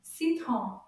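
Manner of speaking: "Citron" as one quick word. Shown as a demonstration. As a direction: join the syllables of "Citron" briskly. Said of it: "say it a little bit faster".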